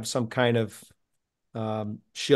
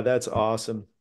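ordinary delivery

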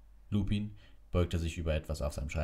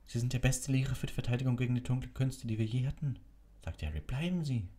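pleading; 8 semitones higher than usual. Acted. Harry pleads with Lupin.

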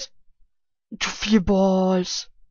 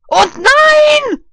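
very high loud shout; frustrated shout; 13 dB louder than usual. Real, not acted. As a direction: yell at the loss. Loses again.

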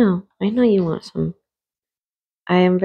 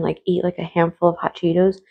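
ordinary talk

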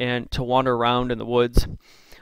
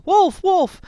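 much higher and louder cry; yelling for help; 6 dB louder than usual. Acted. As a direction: call out in panic across the woods. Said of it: "hears somebody yelling".

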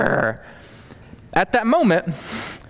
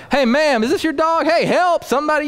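frantic call for help; 12 semitones higher than usual. Retold or imitated. Man calling to the dog owner for help, high and panicked.

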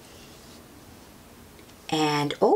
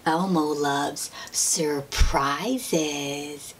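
plain reading voice

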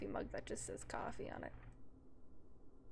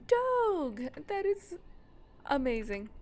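loud, high delighted cry; excited shout; 4 semitones higher, 6 dB louder, slower than usual. Real, not acted. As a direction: gush over the dog at full volume. Spots a dog in game.

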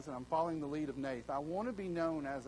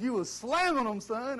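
a hint of a ribbing older friend, higher and louder; teasing friend; 7 semitones higher, 7 dB louder than usual. Roger teasing the pastor about his baptisms, mildly voiced. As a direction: tease him like a buddy at Life Group. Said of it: "He said".

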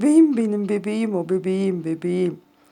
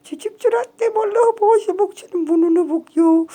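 much higher cooing voice; 10 semitones higher, 4 dB louder, faster than usual. Real, not acted. Owner gushing over her cat's face, high baby talk.

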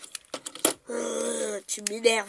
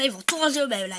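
normal talking voice

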